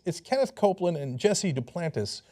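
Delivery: ordinary talk, nothing marked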